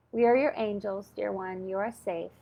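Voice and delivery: gentle angels reassuring a scared woman; soothing angel voice. Retold or imitated. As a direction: comfort her like a guardian angel.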